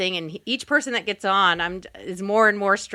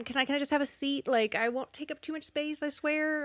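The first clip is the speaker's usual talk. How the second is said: higher and softer, quick nervous plea; timid pleading; 5 semitones higher, 7 dB quieter than usual. Retold or imitated. Passenger pleading with a seatmate for room, nervous and soft. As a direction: nervously beg for a bit of space.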